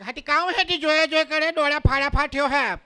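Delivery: put-on high, scolding Surti accent; hammed-up Surti dialect, pitched high; Surti woman. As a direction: tell him off like a Surat woman. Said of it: "સુરતની બહેનોને કોક જોઈને".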